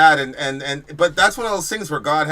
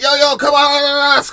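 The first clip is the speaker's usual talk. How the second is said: high, loud re-enacted call to come fight; urgent yell; 11 semitones higher, 9 dB louder than usual. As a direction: yell it out like summoning someone in a panic. Acts out an urgent call for help.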